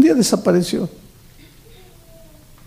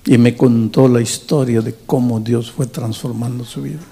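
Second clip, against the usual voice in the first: normal talking voice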